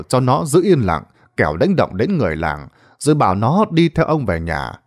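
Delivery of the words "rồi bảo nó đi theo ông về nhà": plain reading voice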